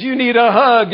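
a mocking hint of a gentle judge. Judge's soft reply mocked.

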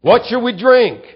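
high, loud whiny demand; grumbling complaint. Retold or imitated. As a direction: complain like a thirsty crowd. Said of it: "the people grumbled at Moses".

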